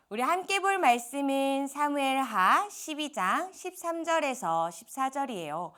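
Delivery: usual speaking voice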